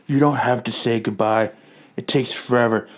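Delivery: low, soft put-on wife voice; a weary wife handling everything. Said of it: "Hank continues with his wife".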